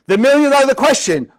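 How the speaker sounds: hammed-up game show presenter; game show host. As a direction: announce it big like a quiz host. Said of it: "like in the game shows".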